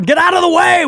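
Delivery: high, sharp mock yell at traffic; half-hearted yell at traffic; mock annoyed driver. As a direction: play the wrestler stuck in traffic honking. Acting annoyed at honking horn.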